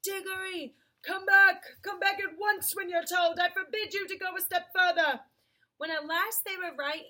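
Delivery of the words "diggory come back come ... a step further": high, loud scolding call; restrained shout. A narrator's reading. Uncle Andrew calling the boy back from afar, shouting. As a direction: shout orders, short of full voice. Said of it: "shouting, but by no means at the top of his voice".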